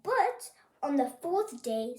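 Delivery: ordinary delivery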